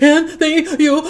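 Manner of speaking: high, loud blubbering thank-you; mock sobbing thanks. Friend imitating a snotty crier thanking someone, mock sobbing. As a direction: squeak it out through a big ugly cry. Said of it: "you know, one of those kind of cries".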